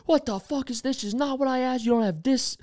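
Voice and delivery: high-pitched furious tirade; angry boss rant. Retold or imitated. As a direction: rant like a boss chewing someone out. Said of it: "laying into this guy".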